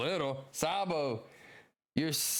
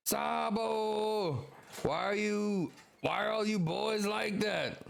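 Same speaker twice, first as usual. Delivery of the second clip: higher-pitched, drawn-out exasperated plea; exasperated comrade; 9 semitones higher, slower than usual. Acted. Comrade exasperated at Sabo's recklessness, higher and pleading. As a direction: sigh it out at a reckless friend. Reading a character's lines.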